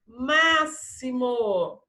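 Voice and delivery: "Máximo" very slow, with long syllables and clear s. Shown as a demonstration. Teacher repeating for the SS column, "Máximo" slowly sounded out. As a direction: dictate "Máximo" as ma-si-mo. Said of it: "palavrinhas com som de dois S".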